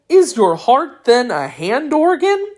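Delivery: puzzled, eager question; a child-like curious voice. A narrator's reading. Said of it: "asked the Pumpkinhead curiously".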